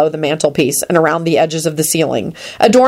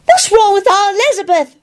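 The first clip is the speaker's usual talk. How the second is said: put-on very high child's wail; acted high, tearful plea; Teddy, wailing. A narrator's reading. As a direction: whine in distress like a small boy. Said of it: "Teddy wailed".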